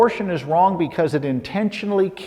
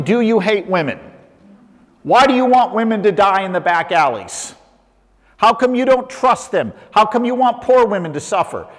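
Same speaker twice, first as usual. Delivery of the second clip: higher-pitched, indignant questioning; mock accusing challenge; 7 semitones higher than usual. Imagined critic challenges a pro-lifer, mock accusing.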